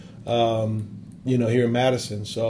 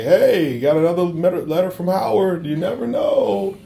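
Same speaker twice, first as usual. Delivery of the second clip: higher, playful mock coach voice, slowing at the end; retold coach's aside; 7 semitones higher than usual. Coach Greg Gard joking to staff about the letters, higher and playful. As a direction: toss it off like a hopeful coach. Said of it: "he'd say".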